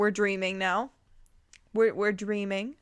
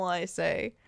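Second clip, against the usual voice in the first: plain reading voice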